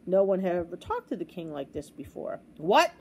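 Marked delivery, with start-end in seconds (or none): 2.6-2.9 loud, outraged outburst; bellowing. A narrator's reading. Offended king to the boy, bellowing. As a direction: bellow it in disbelief. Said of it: "he bellowed".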